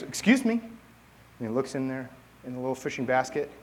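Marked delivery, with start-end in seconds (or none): 0.0-0.5 high-pitched comic frog voice; funny voice. Acted. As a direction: pipe up in a funny little high voice. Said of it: "this funny voice".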